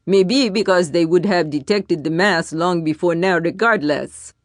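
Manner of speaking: blunt. A narrator's reading. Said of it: "chimed in bluntly".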